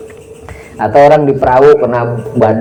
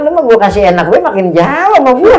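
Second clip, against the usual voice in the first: higher, louder mock voice of God scolding; 10 semitones higher, 4 dB louder than usual. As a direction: speak as God chiding his servant. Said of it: "Jadi kata Tuhan". (suara Tuhan menegur, lebih tinggi dan keras)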